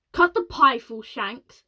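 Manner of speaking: loud angry snap; snapping. A narrator's reading. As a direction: snap at Shanks. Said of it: "snapped the President".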